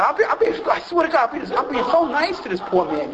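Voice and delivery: high, insistent protest; indignant protest. Retold or imitated. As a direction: insist you were nice. Protesting his niceness.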